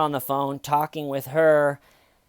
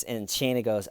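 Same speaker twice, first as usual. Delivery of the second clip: usual speaking voice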